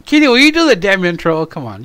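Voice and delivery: ordinary talk, nothing marked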